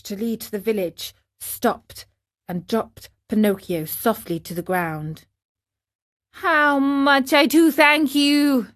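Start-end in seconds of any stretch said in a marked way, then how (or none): 6.3-8.7 higher, slow heartfelt thanks; grateful thanks. Acted. Marionette thanking the dog, grateful. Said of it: "said the marionette".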